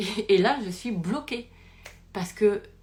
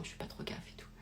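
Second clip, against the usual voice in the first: hushed aside; 15 dB quieter, faster than usual. Real, not acted. Aside while scanning the church.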